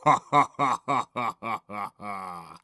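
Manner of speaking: slow, descending villain laugh; evil cackle. A narrator's reading. Narrator revelling in scary movies, evil cackle. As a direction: roll out a spooky monster cackle.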